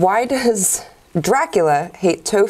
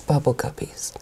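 whispering; 6 semitones lower, 6 dB quieter than usual. Acted. Punchline whispered as a joke.

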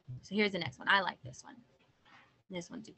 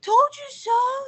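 very high, loud, drawn-out crow; goose's told-you-so; 15 dB louder than usual. Acted. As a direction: shriek it out slowly in triumph. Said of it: "said the Google-eyed goose".

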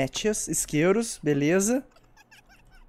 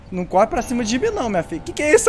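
scared horror game streamer; 7 semitones higher, 5 dB louder, faster than usual. Real, not acted. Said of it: "eu tô com muito medo".

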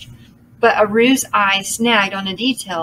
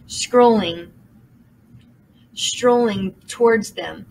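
ordinary delivery, nothing marked

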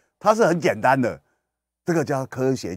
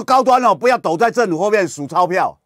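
much higher, louder furious scolding; imitating an angry outburst; 9 semitones higher, 8 dB louder than usual. Angry host shouting down the vaccine maker. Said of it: "周一蔻很气啊".

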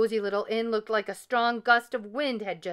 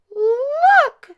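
very high, loud squeal; piercing baby bear outcry; little bear squeal; 13 dB louder than usual. A narrator's reading. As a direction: shriek it as a startled bear cub. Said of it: "squealed Little Honey".

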